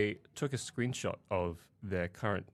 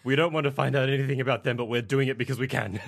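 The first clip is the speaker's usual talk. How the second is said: smug mock quote; 3 semitones higher, 8 dB louder than usual. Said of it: "It's like".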